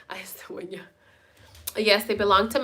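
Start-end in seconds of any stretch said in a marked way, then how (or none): 0.1-0.8 very soft, almost whispered; murmured repeat. Real, not acted. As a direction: murmur it under your breath. Murmured repeat after loud call.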